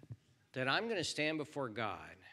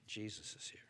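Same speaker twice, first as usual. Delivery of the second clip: whispered; 4 semitones lower, 10 dB quieter than usual. Real, not acted. Quiet reassurance.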